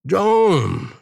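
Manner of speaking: high, long call of a name. A narrator's reading. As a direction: summon him in a high voice. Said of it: "diye seslendi". (tiz sesle, uzatarak seslenerek)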